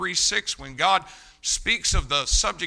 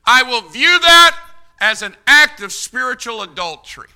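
loud, raised pronouncement, hard on the opening; thundered verdict; 5 semitones higher, 11 dB louder than usual. Acted. God judging love of the world, thundered verdict. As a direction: thunder it as God's judgement. God's verdict, acted by preacher.

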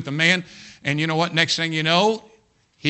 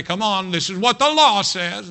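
loud, raised-pitch mock accusation; accusing Pharisee; 6 semitones higher, 5 dB louder than usual. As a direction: play the mob demanding judgment by the law. Voices accusing Pharisees with stones.

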